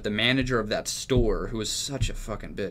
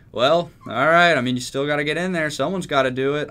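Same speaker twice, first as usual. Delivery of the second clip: loud mock manager; 5 semitones higher, 7 dB louder than usual. Manager insists after robbery.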